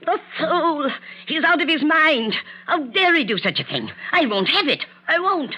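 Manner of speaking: high, sharp indignant fury; shrill mother's outrage; outraged outburst. Acted. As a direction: explode at the news, pitch climbing. Mother furious at son's engagement.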